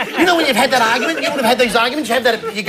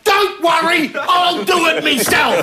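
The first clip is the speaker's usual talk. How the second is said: high, drawn-out exasperated cry; mock an aggrieved wife giving up on him; huffy partner; 6 semitones higher, much slower than usual. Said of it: "she'll come walking in going".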